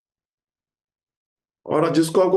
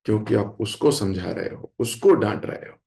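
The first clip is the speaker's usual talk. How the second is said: lower, softer, humble plea; humble folded hands; 7 semitones lower, 5 dB quieter than usual. Retold or imitated. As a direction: speak with joined hands, meek and sincere. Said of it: "हाथ जोड़ के खड़े हो गए".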